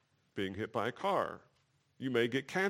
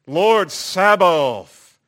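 "Lord" held long, much higher and louder, falling on "Sabaoth"; drawn-out proclamation; 9 semitones higher, 15 dB louder, much slower than usual. Shown as a demonstration. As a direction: Lord Sabaoth drawn out very slow, high and loud. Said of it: "let me just read some of the lyrics to you".

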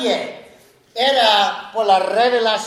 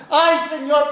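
higher, anguished ay, Señor; pleading cry; 6 semitones higher than usual. Retold or imitated. A repentant sinner crying to God, pleading. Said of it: "delante del Señor llorando".

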